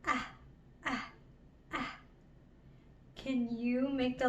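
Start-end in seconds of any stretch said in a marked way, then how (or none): 0.1-2.0 "ah" as a short vowel, cut off quickly; short A sound. Shown as a demonstration. Teacher demonstrates the letter A, "ah" as short separate sounds. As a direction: repeat "ah" in brief, separate bursts. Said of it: "The letter A makes the ah sound".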